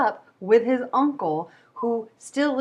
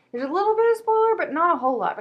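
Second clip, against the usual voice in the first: excited rush; 10 semitones higher, 5 dB louder, faster than usual. Real, not acted. Excited spoiler warning.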